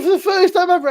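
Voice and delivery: hammed-up repeat of the line; mock exaggerated quote. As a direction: push it up for the joke.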